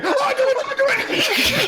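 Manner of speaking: very high frantic shriek; crazy king. Acted. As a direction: wake up shrieking like a mad king. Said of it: "the King acts really crazy about".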